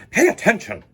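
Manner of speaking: strict music teacher snapping; stern snap. A narrator's reading. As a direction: scold the student sharply. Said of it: "snaps Maestro".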